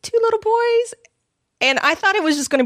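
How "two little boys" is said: high, sing-song mock cashier voice; chirpy mock shop clerk, pitched up; cashier's chirpy question. Imitating the cheery Walmart cashier quizzing her. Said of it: "she's like".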